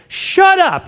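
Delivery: loud, high imitated outburst; frustrated mom's yell. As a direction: yell like an exasperated mother. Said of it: "she's get frustrated".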